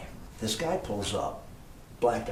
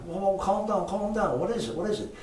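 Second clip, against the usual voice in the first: calming her down; 11 semitones higher than usual. Retold or imitated. Calming the panicked maid.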